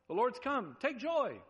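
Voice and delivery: high-pitched, hammed-up call to rejoice; mock exhortation. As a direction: put on an absurdly eager proclamation. Mocks an absurd call to rejoice.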